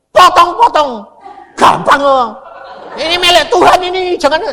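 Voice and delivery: high, loud laughing shout. Retold or imitated. Doctor yells at the villagers, loud laughing shout. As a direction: yell it like a fearless preacher. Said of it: "Saya teriak gitu".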